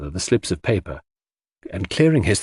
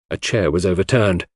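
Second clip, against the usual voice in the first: ordinary delivery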